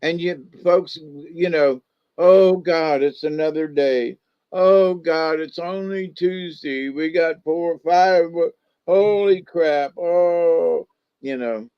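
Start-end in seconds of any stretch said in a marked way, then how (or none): 2.2-10.9 mock groaning dread. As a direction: moan like someone dreading the week. Mimics folks dreading another day.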